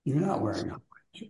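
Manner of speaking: quick, soft whispered aside; a hushed quick whisper to the person beside you. Real, not acted. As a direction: lean in and whisper it fast. Aside to neighbour in circle.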